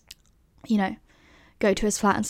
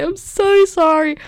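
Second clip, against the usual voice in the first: high, slow, louder mock-tearful whimper; tearful apology; 8 semitones higher, 5 dB louder, slower than usual. Patient apologising to the nurse, high and slow, mock tearful. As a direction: plead like a sobbing student. Retold crying apology at clinic.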